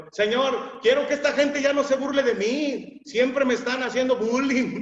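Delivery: high, loud mock complaint; imagined plea. Bartimeo begging Jesus to stop the bullying, mock loud plea. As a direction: whine it like a mocked beggar.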